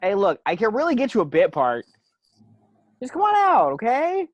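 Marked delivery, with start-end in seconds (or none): 3.0-4.3 much higher, loud coaxing shout; coaxing call. Acted. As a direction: coax her out from afar.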